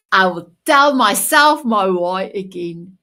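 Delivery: usual speaking voice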